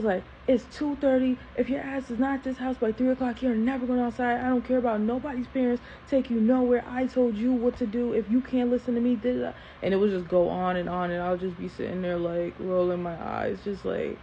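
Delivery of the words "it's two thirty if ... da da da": higher-pitched mock tirade; retold parental rant, not fully screamed; imitating screaming dad. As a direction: lay down the law like an angry dad. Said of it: "this is screaming. I'm not gonna scream".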